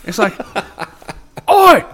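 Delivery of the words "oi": high, loud British street shout; mock UK accent. Joking about the many UK accents, loud mock British shout. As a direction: holler it as a hammed-up Brit. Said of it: "so many fucking accents".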